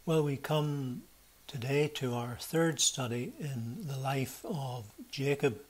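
ordinary talk, nothing marked

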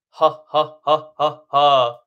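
loud, punched, separate belly-laugh pulses; staged full laugh as a breath exercise; demonstrated belly laugh. Shown as a demonstration. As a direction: push out deliberate ha's from the belly, last one strongest. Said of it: "kind of a deep, full laugh".

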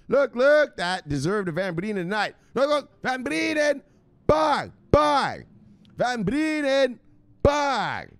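high, loud shouted catchphrase; host signing off viewers with loud shouts. Acted. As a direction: belt it out like a hype man.

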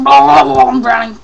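loud, slow, drawn-out drowning cry; drowning impression. Player imitating drowning, loud drawn-out cry. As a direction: act out drowning in drawn-out wails. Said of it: "That's, like, the best impression I can".

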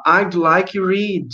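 "I'd like read" spoken slowly and clearly; teacher models the polite request slowly. Shown as a demonstration. Teacher shows pupils how to ask politely, said slowly. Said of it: "aí você pode falar".

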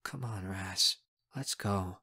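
low, soft urging; quiet. A narrator's reading. Friend shakes her head and gives in, quiet. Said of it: "says quietly".

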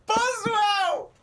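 high shrieking cry of Boswell; shrieked mockery. Real, not acted. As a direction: scream Boswell back in disbelief. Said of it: "you said Boswell".

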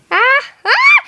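high shouted evil cackle; unhinged villain laugh. Acted. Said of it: "Stop doing an evil laugh".

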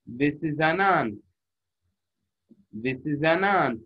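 slow, words run together as one; linked smoothly. Shown as a demonstration. Teacher showing students linked reading, slowly. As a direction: glide the words into one phrase. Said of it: "وكأنه كلمة واحدة".

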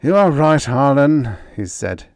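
high, loud villain voice; forced calm over menace; Renzo voice. Acted. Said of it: "He appeared more calm now".

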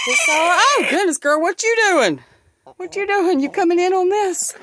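high loud coaxing sing-song; talking to a hen. Real, not acted. Speaking to the hen, high loud coaxing sing-song. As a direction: coo up high at the hen like a pet.

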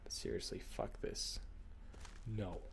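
breathy under-the-breath curse; whispered frustration. Real, not acted. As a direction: whisper it in disgust.

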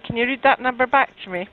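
ordinary talk, nothing marked

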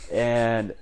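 plain reading voice

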